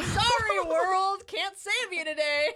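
mock heroine apology. Mock magical girl apology.

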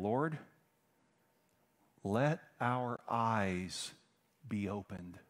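very drawn out, sinking softly; slow solemn plea. Acted. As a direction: stretch each word of the plea. Blind men's plea read slowly.

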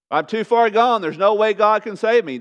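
loud, high acted objection; protesting sinner. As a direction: cry it out as a lost cause. Acts a hopeless sinner's objection.

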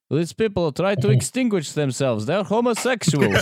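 high, slow mocking spectator voice; lawn chair onlooker. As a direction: drawl it out high, laughing. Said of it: "there's a guy in a lawn chair going".

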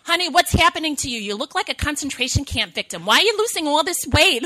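put-on high, fast, loud Brooklyn accent; thick Brooklyn accent. Doctor questioning a patient's weight loss, thick Brooklyn accent. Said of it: "She has a very thick Brooklyn accent".

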